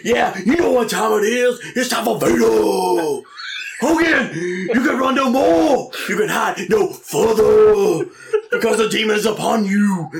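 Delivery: slow menacing wrestler promo; hammed-up heel wrestler taunting Hogan; Vader promo. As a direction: growl a threat at Hogan. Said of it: "pretty good Vader promo".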